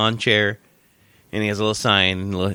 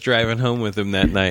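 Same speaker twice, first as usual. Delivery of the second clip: ordinary talk, nothing marked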